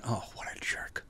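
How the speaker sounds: soft whisper of disapproval; whispered thought. Retold or imitated. Narrator privately judging the rude guy, whisper. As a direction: mutter it under your breath. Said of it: "And I'm thinking".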